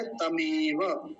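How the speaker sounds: तमेव slowly as ta-mee-va; correct ta start. Shown as a demonstration. Teacher giving our word, slow drawn-out demo. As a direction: stretch the long e of तमेव, no v after t. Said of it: "हमारा शब्द क्या है?".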